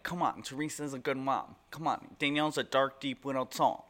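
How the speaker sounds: a light, not-so-great Margaret impression in a thick Jersey accent. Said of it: "that wasn't a great Margaret".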